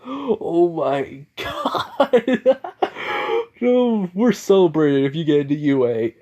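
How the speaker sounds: high-pitched, delighted laughing speech. Acted. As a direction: crack up in gleeful disbelief, drawing words out. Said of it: "he just starts laughing".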